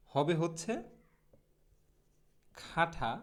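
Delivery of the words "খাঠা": খাঠা alone, aspirated "kha-tha" with no r; syllable-by-syllable demo. Shown as a demonstration. Teacher showing learners the first half, খাঠা with puffed kh and th. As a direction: break off খাঠা as its own piece. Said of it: "সঠিক উচ্চারণটা".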